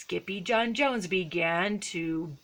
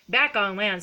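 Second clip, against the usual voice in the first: ordinary delivery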